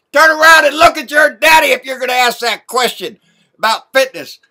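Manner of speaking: loud, furious scolding; angry shout. Real, not acted. Ranting vlogger yells at Junior to look at his daddy, furious and loud. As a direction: bellow it at him like a fed-up parent. Yelling at Junior over fitness.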